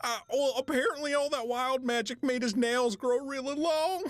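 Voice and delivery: high-pitched, tickled explanation; gleeful report. Acted. Gleefully reporting the mishap, barely containing laughter.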